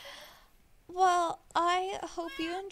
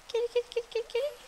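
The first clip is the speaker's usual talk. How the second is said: high sing-song kitty call; calling a cat; 10 semitones higher, much faster than usual. Real, not acted.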